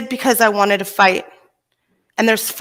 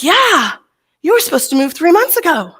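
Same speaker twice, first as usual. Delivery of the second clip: psychic friend; 7 semitones higher than usual. Retold or imitated. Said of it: "So he's like".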